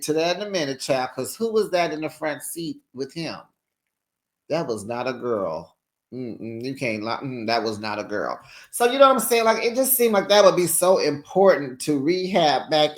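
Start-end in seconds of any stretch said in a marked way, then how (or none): 4.5-8.4 low, quiet, knowing aside; knowing sass. Real, not acted. Host teasing viewers about the car passenger, low and knowing. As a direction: let them know you saw through it.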